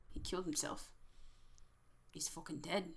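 soft, understated in-character line; quiet, subdued statement; hushed verdict. Acted. Character reacts on seeing the body.

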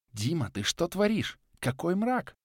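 distant, alarmed wife; a worried voice in his head. A narrator's reading.

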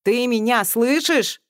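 worried. A narrator's reading. Colonel checks she can still hear him, worried. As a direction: ask anxiously, drawing it out. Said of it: "обеспокоенно спросил".

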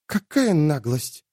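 slow breathy exclamation; breathed out. Acted. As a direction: breathe it out in disbelief. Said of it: "выдохнула Бекка".

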